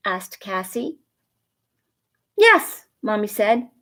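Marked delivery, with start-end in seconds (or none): 2.4-2.9 happy yes. Acted. Mommy agreeing happily.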